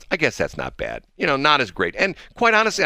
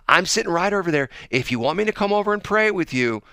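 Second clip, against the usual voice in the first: imitated insistent lady; 10 semitones higher than usual. Retold lady repeating her offer.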